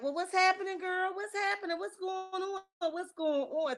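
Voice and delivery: put-on high-pitched, sing-song "what's happening girl"; mock high Melody voice. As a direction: pitch way up, nosy and sweet. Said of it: "Melody like".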